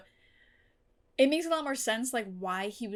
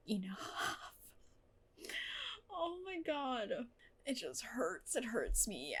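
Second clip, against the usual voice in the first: very soft, hurting; heartbroken hush; 10 dB quieter, slower than usual. Real, not acted. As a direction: barely get the words out, aching. Heartbroken over the scene.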